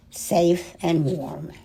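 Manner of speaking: plain reading voice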